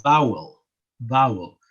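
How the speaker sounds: vowel said the English way, "ow" in the middle. Shown as a demonstration. Teacher contrasting the English way of saying vowel. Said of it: "an English person would say".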